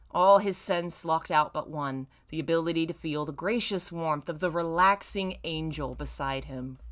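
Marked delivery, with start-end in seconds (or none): none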